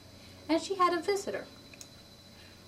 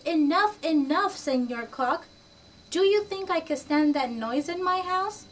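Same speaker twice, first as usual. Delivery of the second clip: annoyed; 6 semitones higher, 5 dB louder than usual. Acted. Martina rejects noisy rooster.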